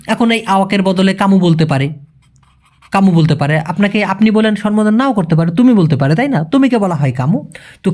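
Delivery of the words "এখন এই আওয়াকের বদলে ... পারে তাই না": ordinary talk, nothing marked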